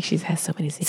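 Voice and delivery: hushed, playful whisper; mock secretive whisper. Joking that her big hair hides secrets, mock whisper.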